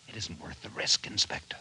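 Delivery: hushed whisper, confidential. Acted. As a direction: lean in and breathe it. Officer confides risk to inspector.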